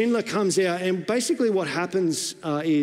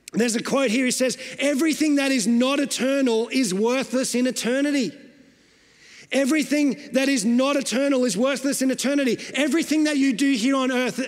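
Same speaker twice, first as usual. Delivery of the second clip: raised pitch, driving sermon delivery; impassioned preaching; 7 semitones higher than usual. Real, not acted. Preacher warning a congregation not to waste their lives, impassioned. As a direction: urge the congregation with insistent, emphatic phrases.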